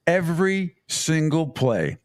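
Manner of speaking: "every" hit hard, slow and drawn out; emphatic stress. Real, not acted. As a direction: lean slowly on "every single play". Insisting every play, not occasionally.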